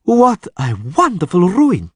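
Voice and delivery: high, slow, rapt delight; genuine rapture. A narrator's reading. Erdil gazes at the ruined house in rapture. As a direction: savour the ruin lovingly. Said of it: "with genuine rapture".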